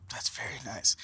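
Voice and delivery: whispered aside; whispering. Real, not acted. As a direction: breathe it out in a whisper. Aside while looking at photo.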